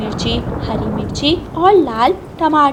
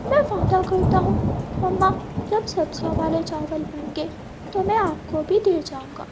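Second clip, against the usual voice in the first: put-on higher, eager child's voice; a small boy eagerly giving his word; little boy promising; 6 semitones higher than usual. Kuku promises mama he'll bring rice, eager childlike voice.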